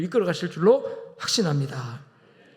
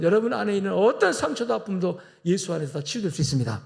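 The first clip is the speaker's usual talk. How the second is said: ordinary talk, nothing marked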